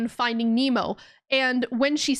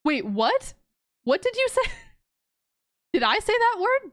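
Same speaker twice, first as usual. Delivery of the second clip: surprised; 10 semitones higher than usual. Real, not acted. Confused by chat's reading.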